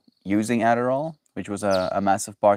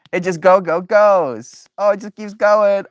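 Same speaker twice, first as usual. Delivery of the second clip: excited squeal; 10 semitones higher, 7 dB louder than usual. Real, not acted. Excited about toy going.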